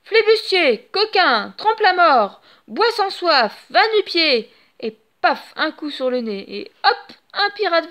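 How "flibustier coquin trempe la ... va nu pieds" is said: loud, high string of insults; a boy yelling insults at pirates; hurling insults. A narrator's reading. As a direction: fling each insult like a stone. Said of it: "sort toutes les injures de son répertoire".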